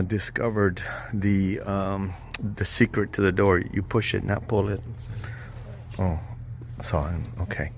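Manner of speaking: hushed voice inside a church; quiet. Real, not acted. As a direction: keep it low, respectful hush. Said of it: "we have to be quiet in here".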